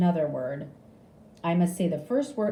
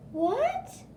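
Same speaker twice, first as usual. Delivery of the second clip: very high startled question; shocked what. A narrator's reading. Nurse shocked by the patient's answer, very high what. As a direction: jump up in shock.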